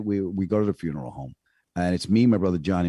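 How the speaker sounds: normal talking voice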